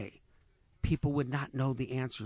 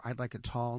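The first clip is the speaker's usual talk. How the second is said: ordinary delivery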